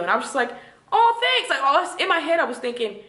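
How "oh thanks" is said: high-pitched polite thanks, put on; feigned gratitude. Woman humoring her date over the bill, feigned gratitude. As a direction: mimic being sweetly grateful.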